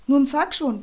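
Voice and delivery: mother pressing him to answer; insistent, impatient mother. A narrator's reading. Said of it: "bedrängelte ihn seine Mutter".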